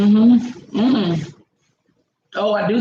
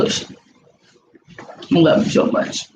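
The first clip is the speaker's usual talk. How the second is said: tender whisper; 3 semitones lower, much slower than usual. Real, not acted. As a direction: breathe it lovingly. Whispered love to partner.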